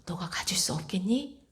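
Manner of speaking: hushed, near-whispered question; soft imploring voice of Jesus; tender, earnest plea. Retold or imitated. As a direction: ask very softly, as something precious. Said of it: "너무나 간절하게 말씀을 하시는 거예요".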